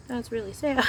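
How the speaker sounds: high, wry self-pitying sigh of words; joking to viewers about her lack of practice partners, high and wry; mock sadness. Real, not acted. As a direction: laugh off her own sad situation. Repeats the joke about her situation.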